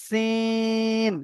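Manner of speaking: সিন with a whistle-like s and a very long vowel; সিন held for three alif beats; whistling Sin. Shown as a demonstration. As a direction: stretch সিন slowly, hissing the s. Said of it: "তিন আলিফ টান হবে".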